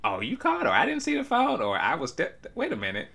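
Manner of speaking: high, sing-song fake excuses; mock parent excuses. Mimicking parents who missed her call, high sing-song excuses. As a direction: put on the parents' innocent excuse voice. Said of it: "when I'm talking to them later on".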